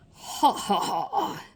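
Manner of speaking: sly husky laugh from the fog; a murmured villain's chuckle, last ha jumping up; murmuring cloud laugh. A narrator's reading. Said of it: "the husky, murmuring laugh".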